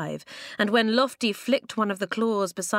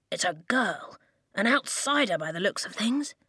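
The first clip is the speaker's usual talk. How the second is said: ordinary delivery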